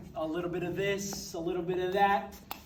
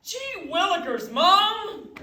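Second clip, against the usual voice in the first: high, loud whiny schoolboy; gee-whiz kid; 10 semitones higher, 7 dB louder, much slower than usual. Acted. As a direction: whine like a 1950s schoolboy. Son's gee-whiz protest.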